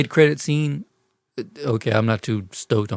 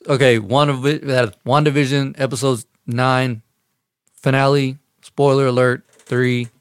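show intro; 3 semitones higher, 4 dB louder than usual. Acted. Announces episode review intro.

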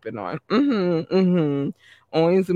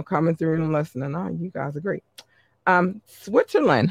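usual speaking voice

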